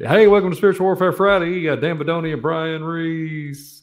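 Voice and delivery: high, bright shop-counter greeting; hammed-up cashier welcoming customers; mock cashier welcome. Imagining himself greeting customers at the Boston gas station, hammed-up high welcome. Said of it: "And then".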